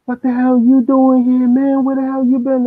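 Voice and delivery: high, loud, startled call; shocked greeting. Retold or imitated. Brother running down, shouting in high, loud surprise. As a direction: shout it in disbelief at seeing him.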